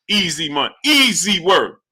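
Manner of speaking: ordinary delivery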